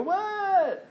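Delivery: high, put-on whiny child's "What?"; caught child. Preacher voicing child caught in cookie jar, high whine. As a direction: play the child caught red-handed. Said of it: "they go".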